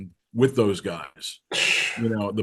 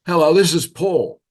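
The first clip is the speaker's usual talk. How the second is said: higher, louder British phone caller; mock British accent, pitched up; 7 semitones higher, 6 dB louder than usual. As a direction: imitate a British man introducing himself. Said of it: "with a British accent".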